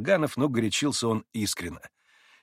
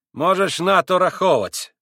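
slow, louder contemptuous cut-off; 7 semitones higher, 7 dB louder than usual. A narrator's reading. Tall Pole answers Grushenka with contempt. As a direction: cut her off with scorn. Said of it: "презрительно отрезал высокий пан".

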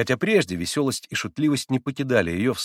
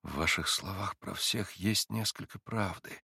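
soft, slow admission; quietly; 9 dB quieter, slower than usual. A narrator's reading. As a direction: say it almost to himself. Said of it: "проговорил тихо Алёша".

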